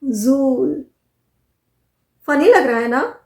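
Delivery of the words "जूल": जूल repeated as one syllable, "jool"; correct pronunciation. Shown as a demonstration. Teacher repeats it for learners, जूल with one long oo. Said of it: "this word is pronounced as".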